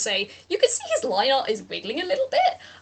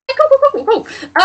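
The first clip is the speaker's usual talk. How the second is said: high, loud string of cools; excited cool; 14 semitones higher, 10 dB louder than usual. Real, not acted. Game master reacting to a roll, loud and high. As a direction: blurt out cools in delight. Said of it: "GM excited at roll".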